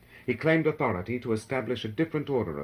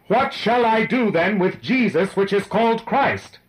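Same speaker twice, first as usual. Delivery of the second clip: high, raised voice over a roaring mob; loud, urgent appeal; 10 semitones higher, 8 dB louder than usual. A narrator's reading. Pilate asks the mob what to do, appealing loudly. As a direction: call out the question, pitched high.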